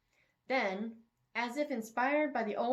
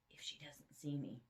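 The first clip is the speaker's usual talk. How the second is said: muttered aside; 5 semitones lower, 11 dB quieter than usual. Acted. Hero muttering his sneaky plan.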